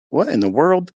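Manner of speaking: retold bewildered exclamation; astonished. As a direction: blurt it out in happy disbelief. Husband reacting to positive test.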